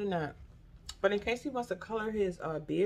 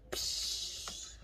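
soft unvoiced psh like a spray; imitated splatter noise; splash sound; 7 dB quieter than usual. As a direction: mimic liquid bursting out. Imagining drink splattering everywhere.

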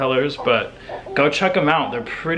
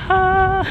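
high sharp yelp of alarm; panicked yelp; 5 dB louder than usual. Retold or imitated. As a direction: squeak out in panic. Panicked at baby screaming.

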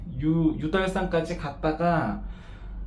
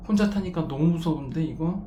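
ordinary talk, nothing marked

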